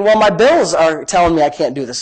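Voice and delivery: ordinary delivery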